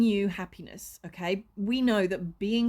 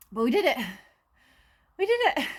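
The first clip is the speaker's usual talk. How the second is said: finally moved in, elated; 3 semitones higher, 4 dB louder, much faster than usual. Real, not acted. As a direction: squeal the victory. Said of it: "moving day finally done".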